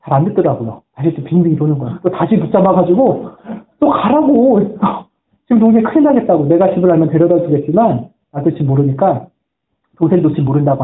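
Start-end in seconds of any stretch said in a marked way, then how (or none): 3.8-6.4 higher-pitched, insistent retold urging; urgent pleading. Preacher retells urging a boy to help his crying brother, insistent. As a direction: press the point, voice raised in pitch.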